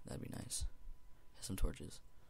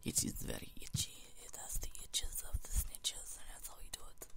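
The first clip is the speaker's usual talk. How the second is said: breathy whispered rhyme; 11 dB quieter than usual. Acted. Whispering a silly rhyme to the viewers. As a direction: whisper it like a secret chant. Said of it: "whispered rhyme to viewers".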